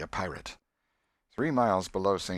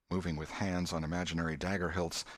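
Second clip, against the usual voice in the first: usual speaking voice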